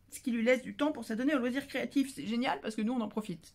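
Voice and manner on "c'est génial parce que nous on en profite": ordinary delivery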